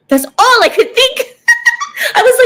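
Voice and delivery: high, loud laughing speech; cracking up at herself. Real, not acted. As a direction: talk through a burst of laughter. Laughing at her own past thoughts.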